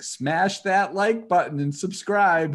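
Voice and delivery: high, loud, slow YouTuber sign-off; kid YouTuber outro. Retold or imitated. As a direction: sell it like a little vlogger. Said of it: "he's like".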